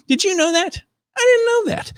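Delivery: squeaky high shocked disbelief; surprised. Real, not acted. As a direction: shoot way up in real surprise. Reacting to surprising news.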